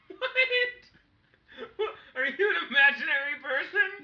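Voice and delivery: high, laughing, can't believe it; laughing disbelief. Real, not acted. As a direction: crack up in disbelief. Shocked at ninety-five pounds.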